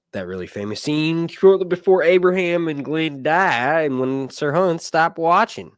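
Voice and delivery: normal talking voice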